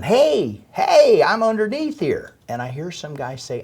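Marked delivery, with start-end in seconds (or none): 0.0-2.3 high, loud re-enacted shout; yelling for help. Survivor calling to rescuers from underneath, yelling. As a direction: call up from under the rubble, pitch leaping high. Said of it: "And I start yelling".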